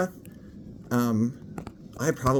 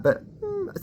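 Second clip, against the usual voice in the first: very high soft hum; high demo hum; 4 dB quieter than usual. Shown as a demonstration. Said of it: "that little hum first".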